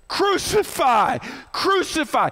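drawn-out high chant of the mob; crowd yelling. Acted. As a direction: call it out like a baying crowd. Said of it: "they're yelling it, they're screaming it".

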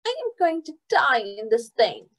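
put-on high whiny little girl; whiny little sibling. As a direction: complain dramatically like a kid. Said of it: "whined her little sister Tip".

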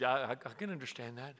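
low, soft, hesitant agreement; placating dad. Retold or imitated. Dad placates his angry son, soft and low. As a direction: stammer a quiet, careful reply.

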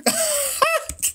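a sudden burst of high laughter. Real, not acted. Laughing at the chat's Paula Dean guess, high burst of laughter. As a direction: crack up at your own Paula Dean joke.

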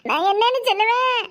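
wailing out bad news; sobbing lament; tearful wail. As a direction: cry out helplessly, drawing out the endings. Breaking death news, crying heard.

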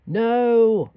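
shouted no; loud no. Real, not acted. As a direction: shut it down loudly. Narrator's loud refusal.

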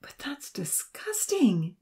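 high, drawn-out pleading; disgusted plea. A narrator's reading. Nancy pleading with Odin over the carcass, disgusted. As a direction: plead in disgust, pitch climbing. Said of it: "Nancy pleaded".

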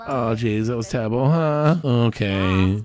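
ordinary talk

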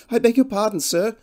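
humble woman's voice, pitched well up; humbly fretful woman. A narrator's reading. Cottage woman startled by a stranger at her door, humbly fretful. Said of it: "She spoke in a humbly fretful voice".

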